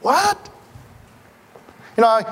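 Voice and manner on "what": amazed what. Real, not acted. Marvelling at God's choice.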